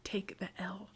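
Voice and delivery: breathy whisper of a sister hushing her; whispered aside to a chattering sister. Retold or imitated. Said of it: "be like".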